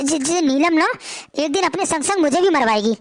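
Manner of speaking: normal talking voice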